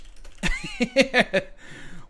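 high, staccato burst of laughter; amused cackle. Real, not acted. Streamer after joking about analytics, amused cackle. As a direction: crack up at your own joke.

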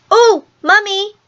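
high-pitched outburst from a little twin; excited child's cry. A narrator's reading. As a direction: cry out to Mummy, pitched right up. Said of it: "cried the first twin".

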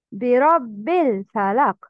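برب الفلق linked as "birabbil-falaq"; dropped hamzat wasl. Shown as a demonstration. Teacher showing linking, no "a" starting الفلق in برب الفلق. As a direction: glide straight from برب into الفلق. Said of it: "Don't pronounce همزة الوصل".

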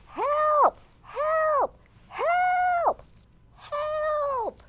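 high loud squeaky shouts; cries for help. Acted. Mouse calling out from the lake, high frantic shouts. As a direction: yell for help, drowning mouse.

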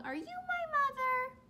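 put-on high, small baby bird asking; lightly voiced baby bird, high and plaintive; a hint of a lost chick; little bird voice. Bird voiced in read-aloud.

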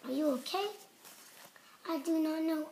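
plain reading voice

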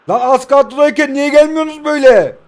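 high, loud exasperated yell; furious rant at the players; angry shout. Real, not acted. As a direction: yell at the team in frustration. Yelling at the team.

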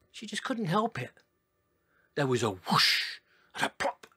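usual speaking voice

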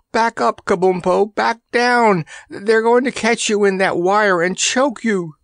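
high, urgent cries of alarm; frantic warning. A narrator's reading. Randy warns Kabumpo of the choking wire, frantic. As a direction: urge your friend back in a high, panicky voice.

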